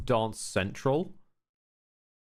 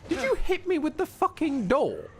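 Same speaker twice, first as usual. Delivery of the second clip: shocked yelp; 12 semitones higher than usual. Real, not acted. Hit by a door in game.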